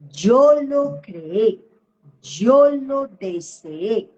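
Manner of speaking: very slow, each phrase set apart by pauses; super slow. Shown as a demonstration. Coach teaching clients her key phrase, said very slowly. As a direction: lay the phrase down word by word, slowly. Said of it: "y la voy a decir súper despacio".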